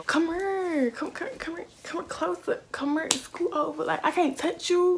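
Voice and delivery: high-pitched mock coaxing voice; imitating her pushy date. Woman imitating her date calling her closer, high mock coaxing. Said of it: "He would do a slick go off".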